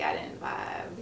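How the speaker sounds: low, slow and soft mock voice; deep mock voice. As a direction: drop low and slow for the quote. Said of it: "I was like".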